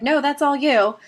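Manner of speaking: mock the husband calling back his refusal. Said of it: "And he's like".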